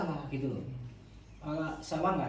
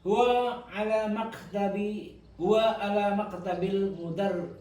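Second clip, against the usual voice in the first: slow, raised dictation pace; slow model reading; 3 semitones higher, much slower than usual. Shown as a demonstration. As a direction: read it out slowly for the class. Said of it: "Saya baca jenengan anu ya". (bacaan contoh lambat)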